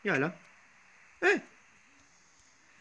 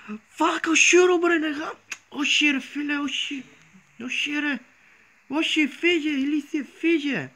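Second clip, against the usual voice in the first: real terror, voice pitched way up; panicked yelling; 9 semitones higher than usual. Real, not acted. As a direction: shriek at the monster to go away. Sees Herobrine, shouts in panic.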